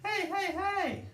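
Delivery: very high, slow, stretched-out cartoon calls; protective Dave. Dave protecting the Chipmunks, high and drawn out. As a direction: call out hey like a protective cartoon dad. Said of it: "David's being protective and going".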